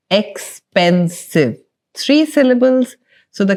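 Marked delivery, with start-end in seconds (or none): 0.1-1.6 "Expensive" drawn out slowly, each syllable separated; syllable count demo. Shown as a demonstration. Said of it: "How many syllables?".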